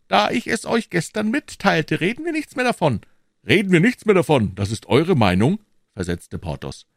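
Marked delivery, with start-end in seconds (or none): 0.1-3.0 high-pitched musketeer closing the subject; high, brisk dismissal; dismissive Aramis. A narrator's reading. 3.5-5.6 louder, lightly mocking repeat; a touch of loud indignation; challenging echo. A narrator's reading. Porthos throws Aramis's words back.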